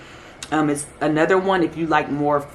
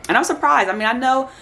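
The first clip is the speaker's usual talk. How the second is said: surprised; 8 semitones higher, faster than usual. Real, not acted. Genuine surprise at fragrance family.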